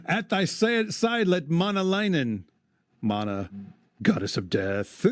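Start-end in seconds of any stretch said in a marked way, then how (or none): 0.1-2.4 measured verse reading; poem recitation. Acted. Host reading the epic to his cohost, recited verse. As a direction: read it aloud like old poetry.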